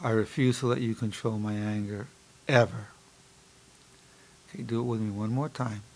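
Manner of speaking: low, soft affirmation after deep breaths; relaxed self-talk. Shown as a demonstration. Psychologist guiding listeners through breathing mantra, calm and low. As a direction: lead the exercise calmly and quietly. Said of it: "I'm taking deep breaths, relaxing".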